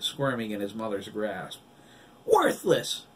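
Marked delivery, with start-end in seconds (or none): 2.2-3.0 very high, loud jeer; yelling back. A narrator's reading. Girl yells the insult back, very high. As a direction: fling it back at her. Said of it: "Diana continued".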